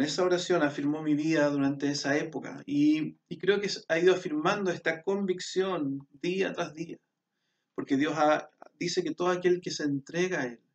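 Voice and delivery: ordinary delivery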